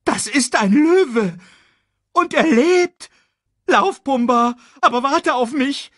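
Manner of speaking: high, loud alarmed cries; panicked meerkat. Acted. Meerkat warning the warthog of a lion, high panicked shouting.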